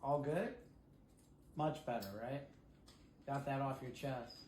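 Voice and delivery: calm the bird down tenderly; soothing. Real, not acted. Owner soothing her parrot after it screamed, soft and gentle.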